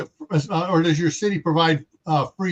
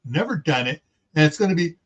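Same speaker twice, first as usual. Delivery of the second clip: normal talking voice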